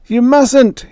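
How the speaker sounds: put-on high, loud old man's cry; croaking old man. Ewing warning Joey off, high and loud. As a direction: rasp out a desperate warning. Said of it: "he croaked".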